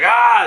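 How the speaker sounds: short, high, loud mock scream; imitated scream. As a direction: cry out like the character. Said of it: "he screams".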